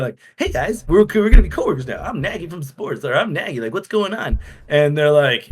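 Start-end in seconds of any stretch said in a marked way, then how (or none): 0.4-4.4 higher, bright-eyed eager greeting; bubbly. Retold or imitated. New coworker greets the lads, bubbly and eager. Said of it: "I walk up with this bubbly personality".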